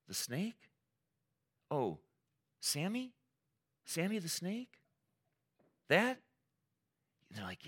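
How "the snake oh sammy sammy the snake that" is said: high, questioning lilt; casual recognition. Retold or imitated. God to Adam and Eve about the snake, casual recognition. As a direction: check who they mean, pitch rising on each short question.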